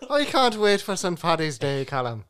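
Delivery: teasing Callum in a mock Irish brogue; Irish accent. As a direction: stretch it out in a hammed-up Irish lilt. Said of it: "spoke in an Irish accent".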